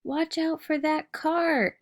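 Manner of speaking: high, slow warning call; yelled warning. Acted. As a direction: call out the danger to your child. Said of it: "she yelled out".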